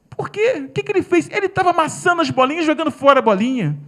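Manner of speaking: high, loud mocking echo; mocking shout. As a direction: throw his question back at him. Woman mocks and shouts back.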